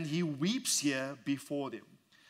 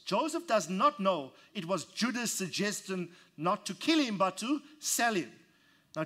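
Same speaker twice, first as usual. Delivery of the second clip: raised, impassioned preacher building; preaching at full force; 4 semitones higher than usual. Acted. Preacher stirring the congregation, impassioned full force. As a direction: preach it out, voice climbing and pushing.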